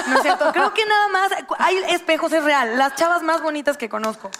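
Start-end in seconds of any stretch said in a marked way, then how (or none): 0.1-1.3 laughing. Real, not acted. Laughs after joke.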